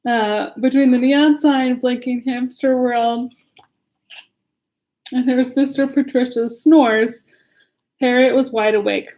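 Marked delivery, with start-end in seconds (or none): none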